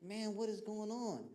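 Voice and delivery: put-on a puzzled kid staring at his crying dad; man, what is going on, said in disbelief; bewildered. Confused by father's crying.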